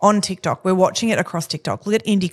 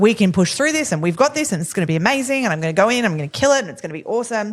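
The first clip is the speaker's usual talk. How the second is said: gung-ho bravado; 2 semitones higher, faster than usual. Retold or imitated. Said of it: "To be like".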